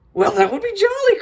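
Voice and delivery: high, hammed-up jolly exclamation; delighted cry. A narrator's reading. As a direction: cry it out with glee, pitch leaping way up. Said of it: "cried the miller".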